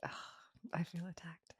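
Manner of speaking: ordinary delivery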